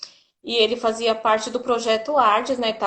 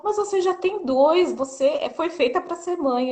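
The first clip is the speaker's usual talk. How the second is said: higher, lightly voiced exasperated friend; astonished friend; 7 semitones higher than usual. Friend finds Gi crying over a pregnancy test, higher mock disbelief. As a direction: play the friend who can't believe it. Friend insisting she's made for motherhood.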